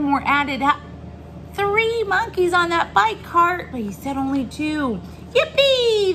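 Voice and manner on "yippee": high, loud "yippee" squeal; gleeful cheer. A narrator's reading. Monkeys riding the golf cart, gleeful cheer. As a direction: let it burst out with glee. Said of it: "they all wiggled and giggled with glee".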